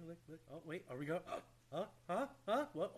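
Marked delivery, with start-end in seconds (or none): none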